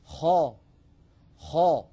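خا pushed up to the roof of the mouth, loud; emphatic kha demo. Shown as a demonstration. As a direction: say خا heavy for the class to repeat. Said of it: "أنطق وحاولوا أن تنطقوا معي". (خا مفخمة للترديد)

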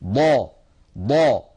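loud, heavy ضا; emphatic dad drill; dad demo. Shown as a demonstration. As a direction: push ضا out from the tongue's side. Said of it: "ما يحاذيها من الأضراس العليا".

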